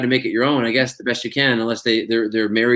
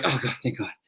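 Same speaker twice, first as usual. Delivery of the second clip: much softer, relieved under the breath; relieved mutter; 9 dB quieter than usual. Retold or imitated. Actor after a long monologue, softly relieved. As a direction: sigh it out like you just survived.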